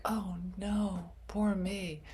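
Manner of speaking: high whiny poor-me voice; mock victim. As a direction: whine like a self-pitying victim. Acting out victim role.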